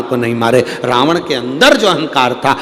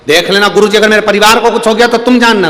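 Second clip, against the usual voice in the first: fast, raised worried warning; anxious warning; 6 semitones higher, 6 dB louder, faster than usual. Retold or imitated. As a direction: press the guru hard, half threatening. Disciple warns the guru. (घबराई हुई चेतावनी)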